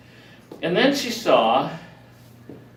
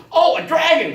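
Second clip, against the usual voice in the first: excited gasp; 13 semitones higher, 8 dB louder than usual. Retold or imitated. Excited at first sight of staff.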